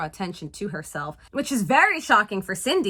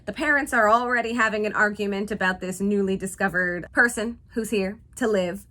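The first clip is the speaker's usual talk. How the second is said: usual speaking voice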